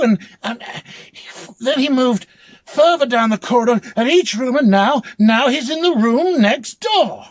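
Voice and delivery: loud, high, stammering panic; frantic disbelief. Acted. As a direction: babble it out, rattled and scared. Panicked protest to the clerk.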